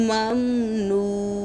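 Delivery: মামনুন chanted slowly, ending on a bare নুন with no vowel after it; silent final vowel. Shown as a demonstration. Teacher showing students the pausal নুন, slow chant. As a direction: stretch মামনুন and stop dead on a vowelless নুন. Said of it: "নুন সাকিন হবে".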